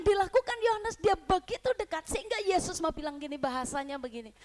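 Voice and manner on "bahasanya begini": ordinary delivery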